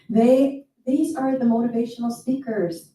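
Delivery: ordinary talk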